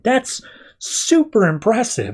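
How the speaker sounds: high, slow, gushing; gushing flattery. Retold or imitated. Harper buttering up Mad Hatter, gushing.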